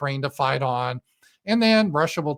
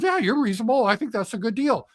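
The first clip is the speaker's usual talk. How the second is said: high, eager parody of Trump agreeing; mock agreeable Trump; 8 semitones higher than usual. Trump flattering Putin on the phone, mock eager voice. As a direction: play Trump nodding along, pitched up. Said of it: "talks to Putin and goes".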